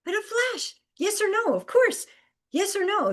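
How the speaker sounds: bright high-pitched insistence; emphatic, high. Acted. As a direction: toss it off high like it's obvious. High emphatic repeating of answer.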